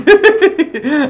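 high giggling laugh; gleeful laugh; burst of laughter. Real, not acted. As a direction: crack up. Laughing after pushing NPC.

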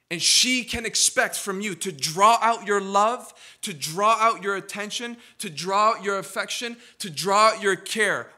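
rising fervent preacher cry; preaching at full force. Acted. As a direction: build the sermon to a big climax.

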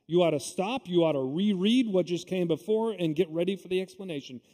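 usual speaking voice

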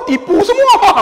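very high, loud disbelief; hilarious exposed-trick exclamation; laughing outcry. Retold or imitated. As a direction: shriek it through laughter. Laughing exclamation at fake wheelchair users.

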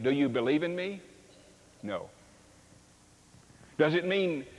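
ordinary delivery

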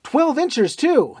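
high, loud and thrilled; very high eager cry; excited shout. A narrator's reading. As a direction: cheer the big guns on. Fallon excited by shelling.

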